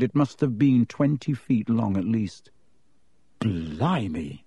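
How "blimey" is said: a weak, low, faint Blimey; barely gets the word out. A narrator's reading. Ron sees the huge snake skin, weak and faint. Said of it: "said Ron weakly".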